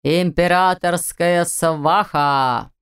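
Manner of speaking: slow booming herald's announcement; town crier. A narrator's reading. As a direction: call out the name like a herald. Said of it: "грянул голос глашатая".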